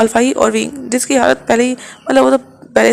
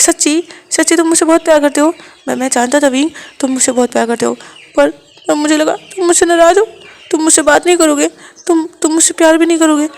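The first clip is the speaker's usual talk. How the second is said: desperate plea; 5 semitones higher, 5 dB louder than usual. Acted. Wang ji desperately begs the dazed Wing to talk. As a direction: shake him awake with your voice. Wang ji overjoyed then anxious.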